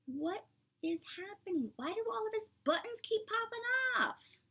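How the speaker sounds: puzzled wonder. Acted. Teacher asking the children why buttons pop. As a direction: wonder aloud in a high voice.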